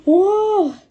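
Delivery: loud tearful lament; wailing sobbing plea; crying. A narrator's reading. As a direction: plead through tears. Distraught weeping plea.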